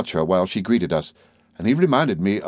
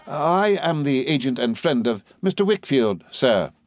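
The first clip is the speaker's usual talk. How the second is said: higher but even formal voice; composed, businesslike; 6 semitones higher than usual. A narrator's reading. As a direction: state your credentials without fuss. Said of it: "in a composed and businesslike way".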